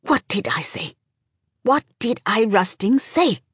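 tightly controlled anger, rising on the repeat. A narrator's reading. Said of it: "To Cutter, she says tightly".

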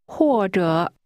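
language-drill 或者, each syllable's tone drawn out; falling, then falling-rising tone. Shown as a demonstration. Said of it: "pronounced with a falling tone".

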